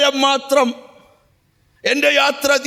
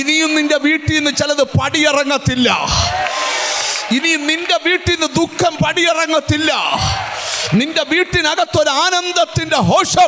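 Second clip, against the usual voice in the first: preaching at full force; 4 semitones higher than usual. Acted. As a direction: proclaim it over the congregation. Prophetic declaration to congregation. (പ്രവചനസ്വരത്തിൽ ഉച്ചത്തിലുള്ള പ്രഖ്യാപനം)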